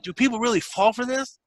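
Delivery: high, disbelieving ask; can't believe anyone falls for it; incredulous question. Retold or imitated. Asking the scammer in high, mocking disbelief.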